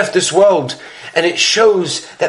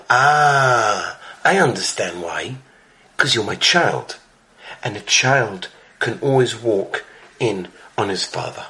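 much lower and softer, gently reassuring; tender father; 9 semitones lower, 5 dB quieter than usual. Retold or imitated. Baba Sali gently reassures his son, low and tender. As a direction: drop the voice low and soft like a loving father.

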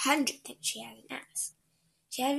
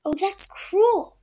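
upset protest; 8 semitones higher, 11 dB louder, slower than usual. Acted. Maid protests.

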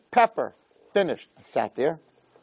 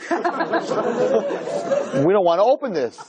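laughing quote; 7 semitones higher, much slower than usual. Retold or imitated. Retelling daughter's worried words.

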